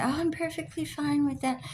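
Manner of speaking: high, airy mock of their casual tone; mock breezy quote. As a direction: toss it off like you don't care at all. Mocking quote of the friend.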